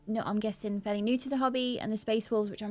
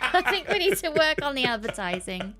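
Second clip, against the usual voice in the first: laughing; 6 semitones higher, 4 dB louder than usual. Real, not acted. Laughing at poor advertising.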